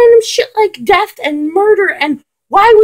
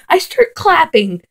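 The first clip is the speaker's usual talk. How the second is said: ordinary delivery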